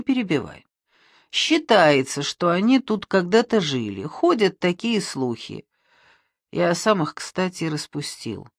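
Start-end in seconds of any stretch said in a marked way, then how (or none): none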